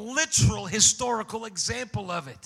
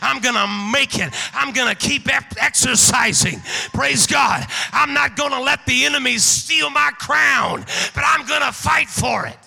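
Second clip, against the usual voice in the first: loud, higher shouted preaching; preaching at full force; 4 semitones higher, 8 dB louder than usual. Acted. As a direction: whoop and holler it to the church. Full-force climax of the sermon.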